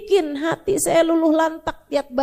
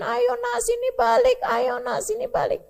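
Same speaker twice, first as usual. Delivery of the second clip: high, pleading call that stays soft; a hint of a desperate father calling his son back; father calling after son; 8 semitones higher, 3 dB quieter than usual. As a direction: beg him to come back. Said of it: "Nabi Nuh liatin".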